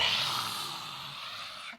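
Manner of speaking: long, forceful breathy sigh; big released exhale. Shown as a demonstration. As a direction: let all the air rush out. Demonstrates untamed exhale.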